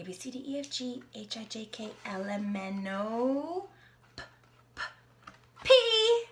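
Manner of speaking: plain reading voice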